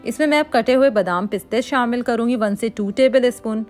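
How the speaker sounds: ordinary delivery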